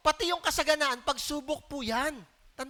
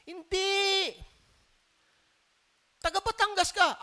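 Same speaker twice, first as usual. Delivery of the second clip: lightly voiced girl, higher and insistent; a hint of a pointed young woman calling him out; mild mock accusation; accusing servant girl; 7 semitones higher, slower than usual. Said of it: "sabi nung isang babatang babae".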